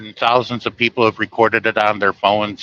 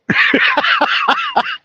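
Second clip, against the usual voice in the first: very high laugh; real laugh. Real, not acted. Wishing he could redo the trip, a very high laugh. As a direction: let a high laugh ring out. Laughing at his own story.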